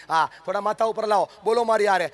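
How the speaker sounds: normal talking voice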